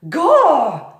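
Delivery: high, loud exclamation; Mig exclaiming. Acted. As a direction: blurt it out dimly. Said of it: "said Mig".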